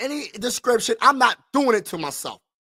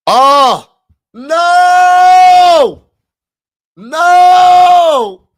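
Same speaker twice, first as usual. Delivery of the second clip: loud, high cry of despair; real anguished outcry; howling no in dismay; anguished shout; 10 semitones higher, 11 dB louder, much slower than usual. Real, not acted. Draft pick goes to rival team.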